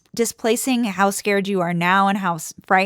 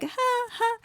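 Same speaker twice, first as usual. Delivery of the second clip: high, soft mock laugh; retold half-hearted laugh, pitched up; imitated weak laugh; 7 dB quieter than usual. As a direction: fake a small, high "ha, ha". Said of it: "Mabel just sort of laughs".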